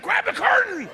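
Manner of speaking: high, loud frantic command; sudden shrieked urgent repeat; panicked yell. Acted. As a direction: scream the order in a panic. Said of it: "He's like".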